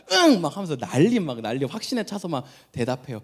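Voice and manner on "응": put-on high, bright confident 응 from a small child; eager child yes. Small child promising her father she will tidy up, excited yes. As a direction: chirp 응 with total certainty.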